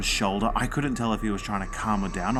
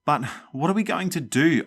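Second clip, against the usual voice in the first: high, louder frantic plea; 9 semitones higher, 4 dB louder than usual. Acted. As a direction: press it again in panic. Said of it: "I repeated frantically".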